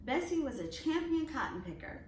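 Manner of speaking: plain reading voice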